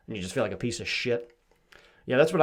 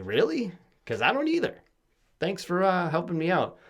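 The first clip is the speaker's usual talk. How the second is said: high mock surprise; 8 semitones higher than usual. Retold reply to therapist.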